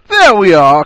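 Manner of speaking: loud, gleeful shout; high, booming exclamation; grinning cry. A narrator's reading. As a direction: cry out in triumph, grinning broadly. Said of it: "cried Ron, grinning broadly".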